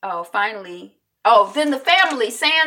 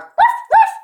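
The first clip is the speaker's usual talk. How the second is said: high, loud yappy barks; imitated dog bark; 10 dB louder than usual. Host supplying the dog's bark, imitated barking. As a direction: bark like a dog for sound effects. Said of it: "we hear the dog bark".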